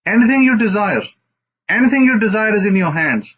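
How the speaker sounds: high, loud proclamation on anything; preaching climax. Real, not acted. Rabbi rousing his congregation, loud and high. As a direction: lift the hall with anything you desire.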